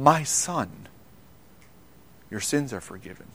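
soft, hushed and gentle, almost breathed; tender hush. Acted. Jesus to the paralyzed man, gently hushed and tender. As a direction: lay the words down like comfort to a broken man.

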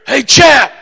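loud, high-pitched shouted hail; booming call. Retold or imitated. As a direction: call out big and booming across a room. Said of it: "it was a deep, booming voice".

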